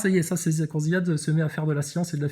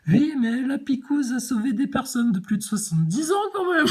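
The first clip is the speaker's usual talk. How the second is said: much higher, whiny mocking voice; mock covidiste; 8 semitones higher than usual. Mocking a vaccine believer, high and whiny. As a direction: parody a naive believer. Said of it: "on croirait un covidiste persuadé".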